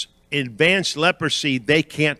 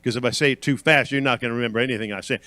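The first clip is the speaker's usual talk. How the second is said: ordinary talk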